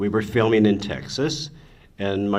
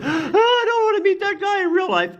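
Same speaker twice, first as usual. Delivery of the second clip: much higher, hammed-up frightened gasp of a moviegoer; mock scared moviegoers; 4 dB louder than usual. Drive-in crowd frightened by the killer on screen, mock terrified squeal. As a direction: squeal it like a spooked audience member in a car.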